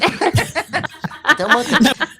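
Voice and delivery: drawn-out laughing no; laughing refusal. Real, not acted.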